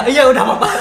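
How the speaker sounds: high, loud mock reply; playful reply. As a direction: toss off a cheeky answer. Voicing the reply.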